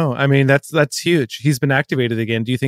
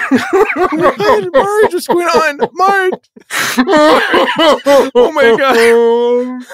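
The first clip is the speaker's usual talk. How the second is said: high-pitched, startled cry; loud, high mock panic; alarmed outcry; 12 semitones higher, 5 dB louder, much slower than usual. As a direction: exclaim in hammed-up alarm. Alarmed at partner's sudden breakdown.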